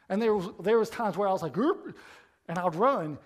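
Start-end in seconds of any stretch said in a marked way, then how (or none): 1.6-2.0 sudden high, loud yelp of alarm; a quick, jumpy cry as danger appears; high startled oop as he breaks into a run. Retold or imitated.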